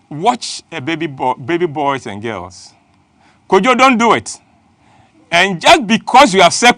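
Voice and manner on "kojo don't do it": put-on sharp warning to a small boy; parent scolding child.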